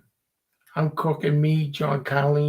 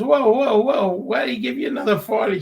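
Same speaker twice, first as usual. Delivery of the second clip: high, loud startled whoa; imitating her shocked double take; an old woman surprised and loud; startled old lady; 7 semitones higher, 5 dB louder than usual. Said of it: "She goes".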